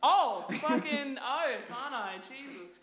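loud, slow, laughing exasperation; mock outrage laughing. Real, not acted. Woman called angry feminist by cohost, laughing outrage. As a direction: fake indignation through laughter.

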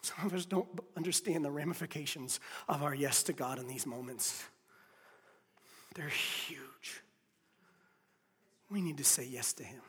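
low, hushed sermon voice; a preacher quietly urging the church; soft preaching. Acted. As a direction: speak gently and close.

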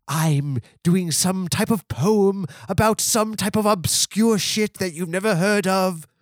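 high mock-British singer impression. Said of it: "Destroyer is always like".

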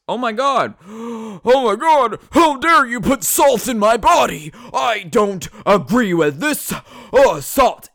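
high, loud hammed-up indignation; mock horrified shriek; mock outraged anti-vaxxer. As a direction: squeal like a scandalised anti-vaxxer at salt. Mock outrage at salt ingredient.

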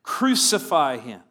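loud, falling demand for crucifixion; crowd shouting. Acted. Chief priests crying out to Pilate, loud angry shout. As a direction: shout it like a furious mob. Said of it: "they cried out".

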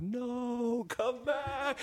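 sudden high, soft cry of no come back; high, quiet panicked plea; panicked take-back. Retold or imitated. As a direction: yelp it high as if grabbing the words back. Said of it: "trying to catch my words".

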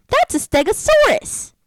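put-on very high, slow child voice; cute little girl. Little girl answering a dinosaur riddle, cute high imitation. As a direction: squeal it out like a proud four-year-old. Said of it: "it's so cute the way she does it".

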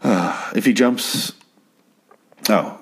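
normal talking voice

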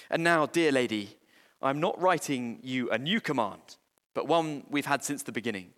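ordinary talk

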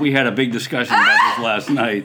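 loud, laughing recount of last night's argument; laughing loudly. Real, not acted. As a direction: burst out laughing while telling it.

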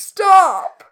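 loud, high shouted protest; sharp cry of horrified protest; appalled outburst. Acted. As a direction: yell it to shut this down. Appalled reaction to the acted seduction.

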